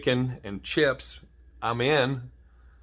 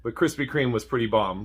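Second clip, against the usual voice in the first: ordinary talk, nothing marked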